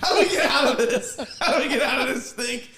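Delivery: high, loud, laughing mock panic; mock panicked kids. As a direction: play the kids panicking, hamming it up.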